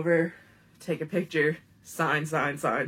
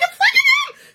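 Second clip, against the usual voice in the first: far higher and louder, laughing squeal on fucking; ecstatic squeal; 10 dB louder than usual. Retold or imitated. Teen texting her friend about the crush, ecstatic squeal. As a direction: shriek it with delight. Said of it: "And I'm like".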